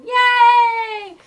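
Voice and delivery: big high shout of joy; loud high cheer. Pretend. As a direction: whoop it out loud. Cheering the snitch catch.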